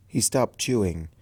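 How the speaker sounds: usual speaking voice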